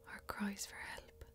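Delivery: usual speaking voice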